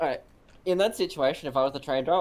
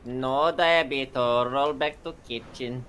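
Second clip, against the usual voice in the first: slow deadpan; 4 dB louder, much slower than usual. Pretend. Mock robotic reply continues bit.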